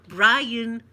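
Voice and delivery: loud imitated name call. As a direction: call it across the tables. Server calling out the wrong name.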